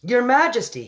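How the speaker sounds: loud, high outraged cry; furious cry. A narrator's reading. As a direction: cry out to the Queen in fury. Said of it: "he cried".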